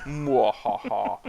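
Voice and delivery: slow hammed-up mwahaha; evil laugh. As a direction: cackle like a mad scientist. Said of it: "There's your evil laugh".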